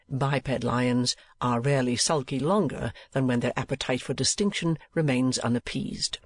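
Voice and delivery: plain reading voice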